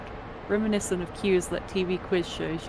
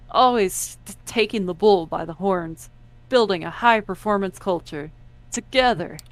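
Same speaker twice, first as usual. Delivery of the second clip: corporate AI pitch; 3 semitones higher, 7 dB louder than usual. Acted. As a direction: hype it like a corporate promo. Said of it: "Kara's voice plays from Hope's speaker".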